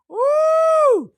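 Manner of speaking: high, loud celebratory whoop; hype whoop. Real, not acted. Host whooping to fire up her viewers, loud and high. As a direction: let out a big excited woo. Signature hype exclamation with laugh.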